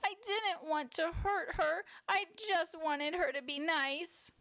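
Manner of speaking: high, tearful little-bear voice; crying. A narrator's reading. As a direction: plead it in a high, crying child voice. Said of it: "buried her face in her paws and cried".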